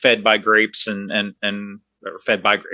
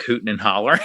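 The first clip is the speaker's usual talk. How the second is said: ordinary talk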